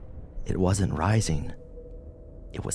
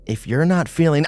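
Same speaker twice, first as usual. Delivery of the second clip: higher, louder pleading; urgent concern; 10 semitones higher, 7 dB louder than usual. A narrator's reading. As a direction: push him to hang back. Maria pleading, cut off.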